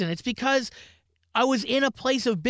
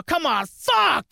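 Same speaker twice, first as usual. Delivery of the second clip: drawn-out, higher mock yell; imitated yell; 7 semitones higher, 4 dB louder, much slower than usual. Example of a gas station employee in Canada, imitated yell. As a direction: imitate an employee yelling, stretching the words. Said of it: "an employee yelled".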